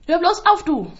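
high, loud furious yell; angry shout. Acted. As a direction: yell at the baby to shut up. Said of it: "ruft Anne ungehalten". (wütend, laut und hoch geschrien)

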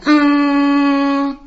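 hoher, lauter Ton; nachgeahmter Piepton. Acted. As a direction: imitiere einen Alarm. Alarmton der Maschine. (nachgeahmter Warnton)

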